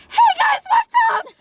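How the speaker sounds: very high, loud squeaky greeting; high-pitched vlog voice. Shown as a demonstration. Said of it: "act like I'm doing it for comedic effect".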